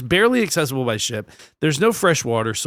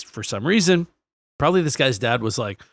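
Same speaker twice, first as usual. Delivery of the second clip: usual speaking voice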